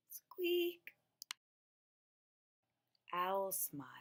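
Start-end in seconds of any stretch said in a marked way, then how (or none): 0.1-0.9 a mousey little whispered squeak; gentle little high squeak; tiny squeak. Acted. Final small squeak.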